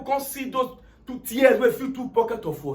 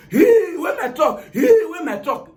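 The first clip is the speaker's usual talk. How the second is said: mock wailing outcry; 6 semitones higher, 5 dB louder than usual. Owner finds his truck gone, mock wailing. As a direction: cry out over a loss, hammed up.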